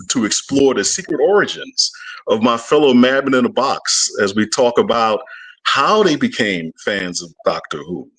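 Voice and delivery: normal talking voice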